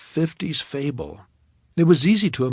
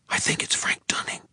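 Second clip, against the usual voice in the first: hushed frightened whisper; 4 dB quieter than usual. A narrator's reading. Narrator to Sadie in a dream, frightened whisper. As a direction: breathe it out, barely voiced. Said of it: "I whispered to Sadie".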